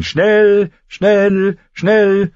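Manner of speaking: loud, high, drawn-out cry; urgent ringing shout; trumpet call. A narrator's reading. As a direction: blare the word like a horn. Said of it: "riefen die Trompeten".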